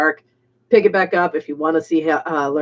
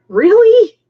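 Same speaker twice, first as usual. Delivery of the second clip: incredulous; 9 dB louder than usual. Real, not acted. Incredulous reaction to viewer comment.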